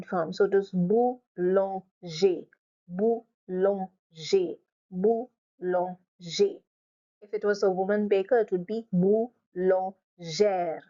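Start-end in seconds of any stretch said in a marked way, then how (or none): 0.7-6.6 "boulanger" slowly as boo - lon - zhay; masculine, syllable by syllable. Shown as a demonstration. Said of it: "going to pronounce the masculine form". 8.9-10.8 "boulangère" slowly as boo - lon - ZHAIR; feminine ending, syllabified. Shown as a demonstration. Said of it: "The ending is different".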